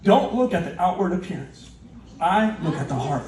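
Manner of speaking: low, measured voice of God; calm, deep divine pronouncement. Acted. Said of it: "And God says".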